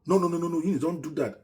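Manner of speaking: high loud pleading no, no, no; shouting. Retold or imitated. Mama shouting at Eunice to let go. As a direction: shout at her to stop. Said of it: "Mama shouted in her presence".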